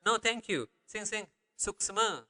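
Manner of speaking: light acted "no thanks"; mock polite refusal. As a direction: demonstrate waving off an offer politely. Demonstrates politely declining with combined phrases.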